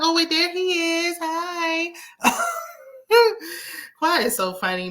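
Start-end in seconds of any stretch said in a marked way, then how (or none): 0.0-1.9 much higher, sugary mock-innocent question; imitating Quad. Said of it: "she's like". 2.0-2.7 very high teasing giggle; mock high giggle. Quad teasing Sweet Tea.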